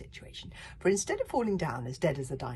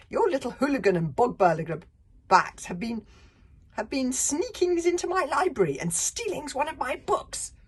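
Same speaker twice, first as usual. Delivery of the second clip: high, loud, nasty librarian voice; angry hairy librarian; 9 semitones higher, 10 dB louder than usual. Acted. Librarian scolding the Viking chief, high and loud. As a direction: spit it out as a furious book-keeper.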